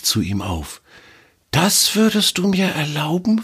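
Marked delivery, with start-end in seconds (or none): 1.5-3.4 high, wondering question with a slight tremble; can hardly believe the offer; slightly shaky voice. Acted. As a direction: squirrel asking in disbelief, a touch shaky. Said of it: "mit etwas zittriger Stimme".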